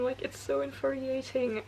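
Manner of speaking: normal talking voice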